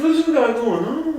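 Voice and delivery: mock imitation. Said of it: "Why are you talking like this?".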